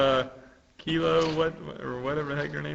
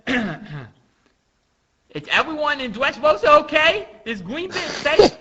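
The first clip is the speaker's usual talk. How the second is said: high, loud, slow cartoon voice; drawn-out, high-pitched worry; worried little character; 11 semitones higher, 10 dB louder, slower than usual. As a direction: ask anxiously in a high put-on voice. Acted worried character from manga.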